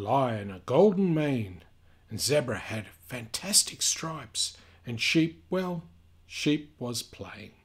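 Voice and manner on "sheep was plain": ordinary delivery, nothing marked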